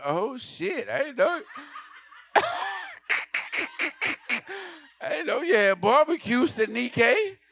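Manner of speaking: high, loud through laughter; giggly mock shock; laughing surprise. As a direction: crack up mid-line.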